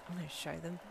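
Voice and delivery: soft, inward resolve; proud thought. A narrator's reading. Competitor privately vowing to win, softly proud. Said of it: "Jinsei thought proudly".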